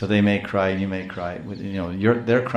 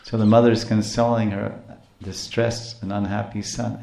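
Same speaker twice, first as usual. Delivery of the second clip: ordinary talk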